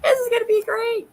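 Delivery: high, excited squeal; thrilled about the plan; breaking into a giddy squeal; giddy excitement. Real, not acted.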